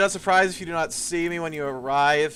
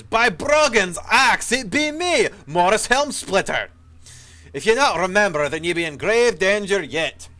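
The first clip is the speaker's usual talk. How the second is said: higher, louder gruff dwarf voice, slow; gruff dry dwarf; 5 semitones higher, 6 dB louder than usual. Acted. Ghost dwarf warning the hero of danger, gruff and loud. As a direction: play an old dwarf soldier, rough and urgent. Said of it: "his voice gruff and dry".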